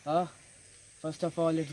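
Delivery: plain reading voice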